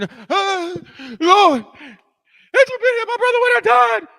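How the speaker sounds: loud, high hammed-up wail; mock wailing. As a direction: act out Mary wailing to the Lord at full volume. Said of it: "Mary comes out wailing".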